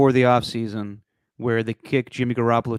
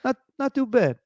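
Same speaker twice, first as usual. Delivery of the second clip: put-on Italian accent; 8 semitones higher than usual. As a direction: give the verdict as a hammed-up Italian-American. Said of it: "do the rest of these in Italian accent".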